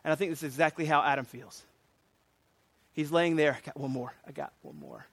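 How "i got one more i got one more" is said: very low, soft undertone; hushed. Acted. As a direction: drop right down, barely voiced. Voicing words to Adam lying there.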